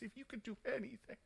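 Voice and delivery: weeping father. Acted. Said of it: "begins to weep".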